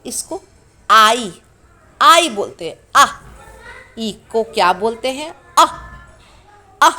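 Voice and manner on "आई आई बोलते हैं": आई said louder, as the letter's name; letter name demo. Shown as a demonstration. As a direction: stress the name आई. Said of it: "ई को हम लोग क्या पढ़ते हैं?". (आई ज़ोर से बोलें)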